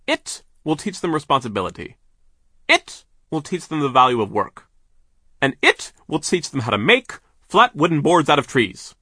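loud, high, emphatic boss voice; big pushy businessman voice; overbearing boss. A narrator's reading. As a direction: boom it out like a self-important owner. Voices the mill owner.

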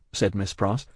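ordinary delivery

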